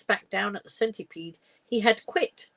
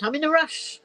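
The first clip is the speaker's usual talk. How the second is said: mild snap; 9 semitones higher than usual. A narrator's reading. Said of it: "Gail snapped".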